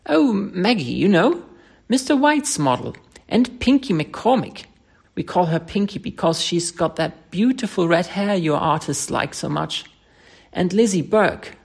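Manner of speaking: chattering. Acted. Tessie lists her friends to the painter, chatty lilt. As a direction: rattle off friends' names cheerily. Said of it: "she began to chatter".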